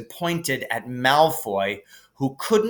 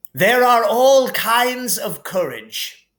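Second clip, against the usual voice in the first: put-on higher, slow, smiling old headmaster; Dumbledore, smiling; 9 semitones higher, 5 dB louder, slower than usual. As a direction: speak with a smile. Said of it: "said Dumbledore, smiling".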